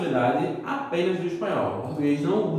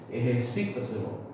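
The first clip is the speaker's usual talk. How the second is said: "Ejercitáculo" lower and softer, beat on "SI"; quiet Spanish model of "Ejercitáculo", "eh-her-SI-ta-se-lo"; stress on fourth-last syllable; 5 semitones lower, 5 dB quieter than usual. Shown as a demonstration. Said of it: "ante-antepenúltima".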